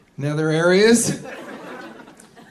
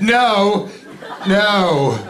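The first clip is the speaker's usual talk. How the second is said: mock father; 5 semitones higher than usual. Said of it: "He said".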